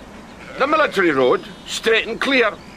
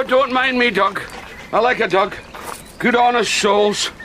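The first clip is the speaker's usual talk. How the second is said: normal talking voice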